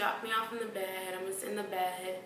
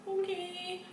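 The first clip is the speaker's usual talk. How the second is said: meek okay; 10 semitones higher than usual. Retold or imitated. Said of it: "I'm just like".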